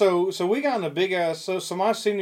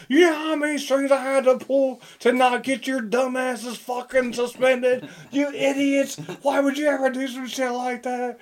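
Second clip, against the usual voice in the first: imitating a coach berating his players; angry coach rant; 7 semitones higher than usual. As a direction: keep tearing into them as the coach. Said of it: "Dude, he ripped into us".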